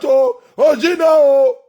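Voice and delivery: usual speaking voice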